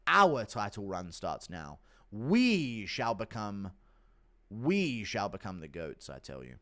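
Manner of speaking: slow, solemn announcement of a title run; grand proclamation. Acted. Promising his title run to fans, slow solemn declaration. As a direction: declare it like an epic prophecy.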